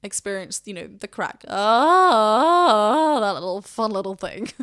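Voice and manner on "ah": loud cracking yodel "Ah" like Tarzan; playful Tarzan call; Tarzan yell demo. Shown as a demonstration. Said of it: "Hello, Tarzan".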